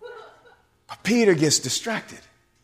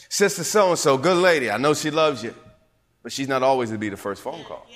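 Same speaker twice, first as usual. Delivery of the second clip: normal talking voice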